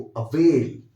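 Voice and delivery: Avail said loud and stretched; stretched stressed avail. Shown as a demonstration. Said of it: "stretch कीजिए word को". (Avail को ज़ोर से खींचकर)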